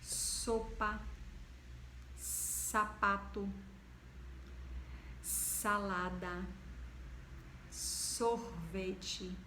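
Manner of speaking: slow, clearly spelled-out S words; teacher drawing out each word for children; slow word list. Shown as a demonstration. Teacher to pupils, slow clear demo words. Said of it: "ele tem um som dele mesmo".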